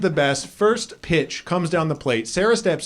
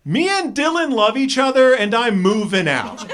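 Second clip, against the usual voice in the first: put-on defiant teen; 6 semitones higher than usual. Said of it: "just goes".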